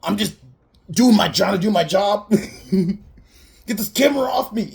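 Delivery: high and loud, laughing through the protest; a hammed-up reporter yelling at the killer; mock shout. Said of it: "and then goes and is like".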